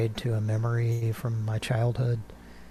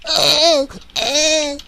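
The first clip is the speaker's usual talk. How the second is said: gagging voice; 10 semitones higher, 13 dB louder than usual. Retold or imitated. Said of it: "He's got the perfect gagging voice for it".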